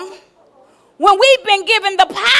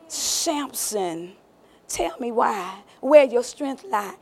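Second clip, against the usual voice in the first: soft coaxing; 6 semitones lower, 7 dB quieter, slower than usual. Acted. Delilah asks again.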